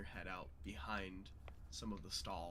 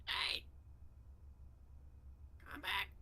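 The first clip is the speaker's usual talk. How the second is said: very high, strained creature call; goblin yelling out. Acted. Goblin calling the others back down the hallway, high yell. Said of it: "kinda yells out".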